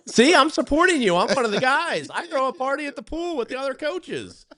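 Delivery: squeaky eager leprechaun. Pretend. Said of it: "leprechaun voice".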